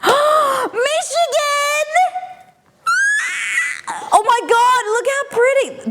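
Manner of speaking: gasp, then high, drawn-out delighted cry; very high, thrilled outburst; excited squeal. Real, not acted. Excited unboxing reaction to mug.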